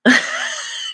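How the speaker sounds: sudden high unvoiced laugh; high burst of laughter. Real, not acted. Laughing at her own cat lady joke, high burst.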